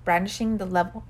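ordinary delivery

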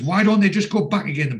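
high, loud acted retort; exasperated retort. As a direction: argue back in imitated exasperation.

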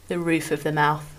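calm, measured phrases with long pauses between them; guided meditation. Acted. Podcast host guiding listeners through a body scan, calm meditation voice. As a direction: ease each phrase gently downward, leaving room to rest.

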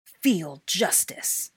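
loud, drawn-out snarled threat. A narrator's reading. Man attacking Draco in the alley, slow loud snarl. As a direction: deliver the sentence slowly and forcefully. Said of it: "the man snarled".

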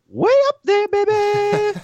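very high, loud comic squeal; gleeful hype. Pretend. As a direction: shoot up into a hyped-up falsetto. Comic hype of Kenny Britt ranking.

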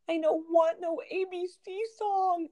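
put-on very high, slow little-kid whine; slow, squeaky imitation of an upset child; imitated crying toddler. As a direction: put on a small child's protest. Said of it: "Blake woke up crying".

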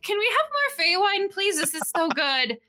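high, airy, breezy reassurance; giggly, wine-happy mom. Acted. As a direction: brush off his worry, happily tipsy. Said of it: "giggly and, like, drinking more wine".

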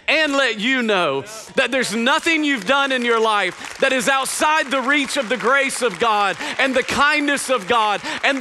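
rising, driving sermon climax at full voice; impassioned preaching. Real, not acted. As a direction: preach it loud and high, building to a peak.

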